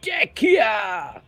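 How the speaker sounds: posh English voice. Pretend. Scot reads a chat message aloud in his posh English voice. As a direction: put on a plummy English accent, a bit of a shout. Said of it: "That's kind of my posh voice".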